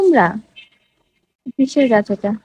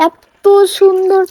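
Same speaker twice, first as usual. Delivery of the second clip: drawn-out admiring gasp; 7 semitones higher, much slower than usual. Real, not acted. Admiring the henna plant.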